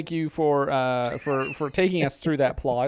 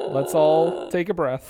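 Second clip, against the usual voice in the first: ordinary talk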